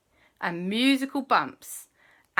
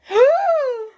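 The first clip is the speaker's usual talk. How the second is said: sharp high gasp; 10 dB louder than usual. Acted. Family discovers tiny Gug-Gug.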